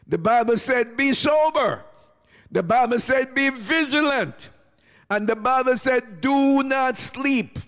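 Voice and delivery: high, ringing commands, climbing step by step; impassioned preaching. Real, not acted. Preacher giving congregation the Bible's warnings, rising exhortation.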